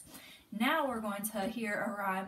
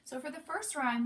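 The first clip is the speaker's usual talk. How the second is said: normal talking voice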